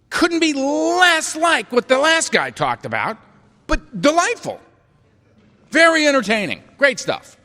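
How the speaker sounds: sarcastic praise. Retold or imitated. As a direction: gush with heavy irony. Sarcastic praise of long shares.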